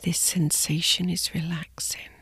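soft slow sleep whisper; soft spa whisper. Acted. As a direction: whisper them gently to sleep. Guided sleep meditation body scan.

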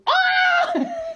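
high shriek breaking into laughter; squeal, then burst out laughing. Real, not acted. Shriek at her own question.

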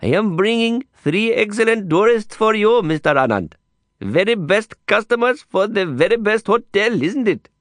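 high, flattering Indian guide voice; butter up the manager, beaming; eager street guide. A narrator's reading. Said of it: "turned his smile to the great manager".

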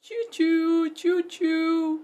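loud, playful choo-choo call; calling train sounds. Acted. Teacher calls the kids to join the pretend train, loudly.

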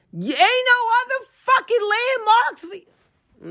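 ranting, voice climbing high; angry outburst. Real, not acted. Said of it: "That was an outburst".

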